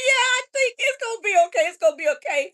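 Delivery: high, loud retold reassurance; loud, tearful reassurance. Answering the struggling mom, loud tearful reassurance.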